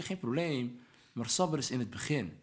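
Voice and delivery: normal talking voice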